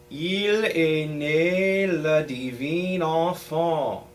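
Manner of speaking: slow, careful French with nasal enfant; French diction demo. Shown as a demonstration. Choir teacher models the French text for boys, slow and careful. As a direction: speak each French word out clearly and slowly. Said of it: "let me speak that text for you".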